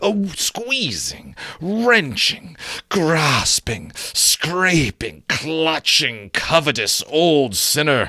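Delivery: rising, punchy string of insults; relishing the insults. A narrator's reading. Narrator savouring a list of Scrooge's faults. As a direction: spit out each word with relish.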